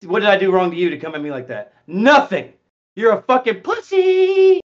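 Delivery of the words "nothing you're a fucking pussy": mocking taunt.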